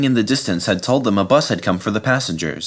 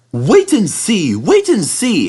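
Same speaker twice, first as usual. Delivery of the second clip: hissed; 10 semitones higher, 5 dB louder, slower than usual. Acted. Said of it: "hissed Thomas".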